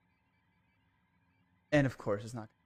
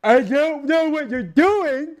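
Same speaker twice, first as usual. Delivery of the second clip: mock game voice; 10 semitones higher, 6 dB louder, slower than usual. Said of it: "it's gonna be like".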